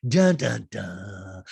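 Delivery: punched dun-dun, dropping low on the last; mock suspense sting. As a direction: hit the sting loud, then let it fall.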